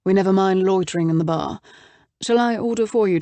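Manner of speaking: put-on a light British accent, brisk; a hint of a crisp British man. A narrator's reading. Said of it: "in a brisk British accent".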